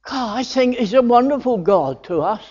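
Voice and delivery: ordinary talk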